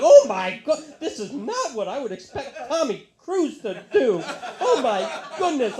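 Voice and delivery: high, loud scandalised gasping; mock shocked old lady. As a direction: clutch your pearls.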